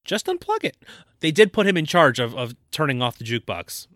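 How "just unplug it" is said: very high, light squeak; high-voiced, teasing suggestion; echoed mock advice. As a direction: echo it far up in pitch.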